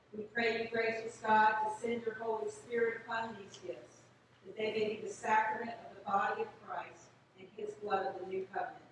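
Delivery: normal talking voice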